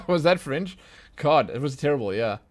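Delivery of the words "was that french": high, loud laugh running into the question; real burst of laughter. Real, not acted. Player laughing at a French line he took for German, amused. Laughs at own wrong language guess.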